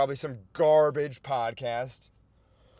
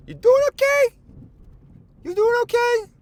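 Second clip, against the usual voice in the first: much higher, louder falsetto check-in; mock concerned inner voice; 12 dB louder than usual. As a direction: squeak it like a worried friend. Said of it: "Jakey thinks to himself".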